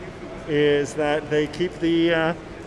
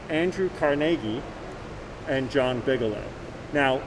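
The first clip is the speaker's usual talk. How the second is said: ordinary talk, nothing marked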